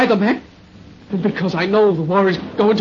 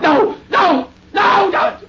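shouting; 9 semitones higher, much slower than usual. Acted. Soldier resists sedation.

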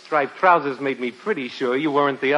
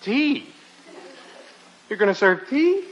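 higher-pitched incredulous question; dismayed disbelief; 7 semitones higher than usual. Acted. Guest hoping for a stronger drink, incredulous. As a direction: question it as if badly let down.

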